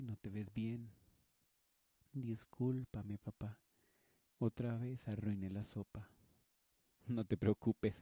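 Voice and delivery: normal talking voice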